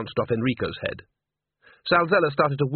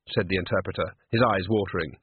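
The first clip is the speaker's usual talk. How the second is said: usual speaking voice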